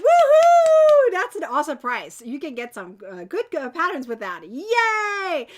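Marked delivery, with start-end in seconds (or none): 0.1-1.1 excited cheer. Real, not acted. Announcing the prize.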